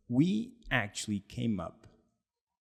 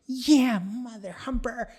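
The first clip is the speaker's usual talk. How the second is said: high, loud imitation of an irritated friend; hammed-up indignant comeback; mock angry retort; 11 semitones higher, 5 dB louder than usual. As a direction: snap back as the annoyed buddy.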